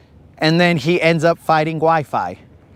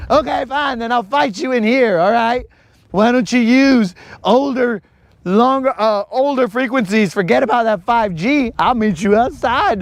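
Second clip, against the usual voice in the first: higher, hammed-up call-out to fight; mock tough-guy challenge; 7 semitones higher than usual. Retelling Colin picking a fight with Wi-Fi, hammed-up challenge. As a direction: playfully dare the Wi-Fi to step outside. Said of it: "He's like".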